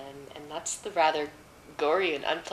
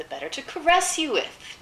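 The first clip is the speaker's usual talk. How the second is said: high put-on granny voice; wolf as grandmother; 11 semitones higher than usual. A narrator's reading. Disguised wolf answers the girl, fake grandmotherly. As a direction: coax her in a raised, fake grandmother voice.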